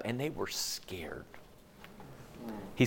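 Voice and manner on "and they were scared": hushed unvoiced whisper; whispering about the fear. Real, not acted. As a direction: breathe it out as a secret. Whispered aside in sermon.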